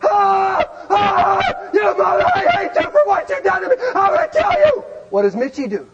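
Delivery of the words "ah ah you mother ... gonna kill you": loud high shriek rising to a screech; screaming in pain. Retold or imitated. Wife screaming at Mitchie in the kitchen, loud and high. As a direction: scream curses, climbing higher to the end. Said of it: "she's screaming at Mitchie".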